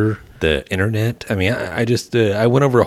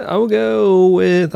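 drawn-out announcer; 12 semitones higher, 4 dB louder, much slower than usual. Pretend. Slow, high far-off announcer delivery.

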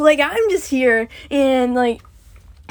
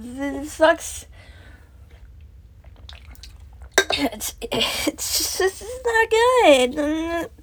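slow, tearful, dragged-out words; real crying; 4 semitones higher, 4 dB quieter, much slower than usual. Real, not acted. Confessing how bad life feels, crying slowly. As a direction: struggle to speak through sobs, slowing right down. Breaks down over own life.

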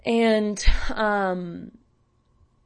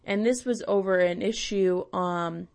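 ordinary delivery

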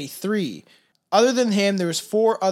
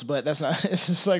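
ordinary talk, nothing marked

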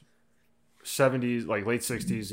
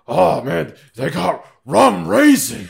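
loud, high, laughing Pacino yell; 9 semitones higher, 14 dB louder, much slower than usual. Retold or imitated. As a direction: blow up over nothing like Pacino. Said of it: "Frank Caliendo would play him, and he'd just be like".

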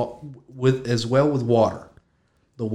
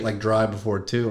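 usual speaking voice

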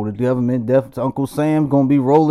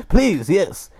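put-on Dutch accent; 8 semitones higher than usual. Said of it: "do not question my accent".

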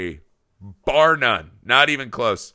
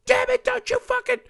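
high-pitched strangled frustration; inner rage. Retold or imitated. Wanting to yell at his kid at bedtime, strangled. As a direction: bottle up the urge to scream. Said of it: "It's like".

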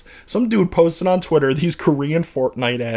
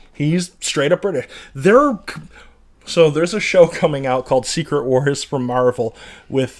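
usual speaking voice

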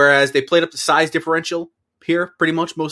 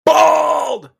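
high, drawn-out mock shout; exaggerated yell, pitched way up; mock outburst; 14 semitones higher, 7 dB louder than usual. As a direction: bellow it out in one long high blast. Mimics outburst about baldness.

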